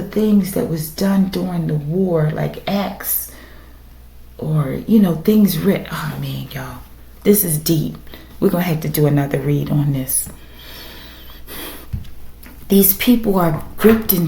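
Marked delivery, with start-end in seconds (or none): none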